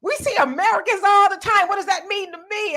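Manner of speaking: high, loud mock scoff; mock dismissive scoff; dismissive retort. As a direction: toss it off like it means nothing. Retelling a dismissive local.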